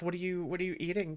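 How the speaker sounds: ordinary delivery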